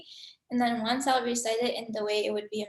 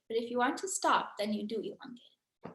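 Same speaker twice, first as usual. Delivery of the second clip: ordinary delivery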